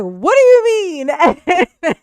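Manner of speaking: high, loud, drawn-out mock wail; terrified screaming. Trapped man answering the killer's bargain, hammed-up terrified scream. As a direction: stretch it into a panicked cry, half laughing. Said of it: "he's really terrified and screaming".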